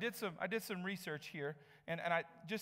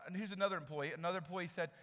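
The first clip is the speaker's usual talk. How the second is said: normal talking voice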